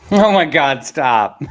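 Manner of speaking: amused, exasperated cry through laughter; laughing disbelief. Real, not acted. Laughing at Bitcoin question.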